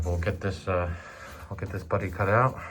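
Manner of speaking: ordinary talk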